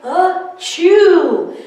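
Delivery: high loud mock achoo; gigantic sneeze. Snowman sneezing hugely for the kids, loud achoo. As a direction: sneeze it big. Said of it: "The snowman sneezed a gigantic".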